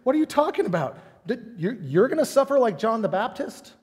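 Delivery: high, baffled what-are-you-saying; confused disciples. Retold or imitated. Disciples questioning Jesus, high and baffled. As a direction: pitch it up in disbelief. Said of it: "They don't go".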